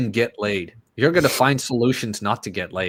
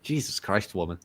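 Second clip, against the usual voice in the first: exasperated mutter; 6 semitones lower, 12 dB quieter than usual. Retold or imitated. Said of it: "It's like".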